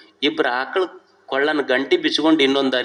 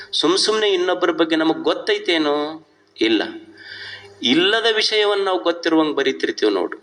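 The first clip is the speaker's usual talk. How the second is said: ordinary talk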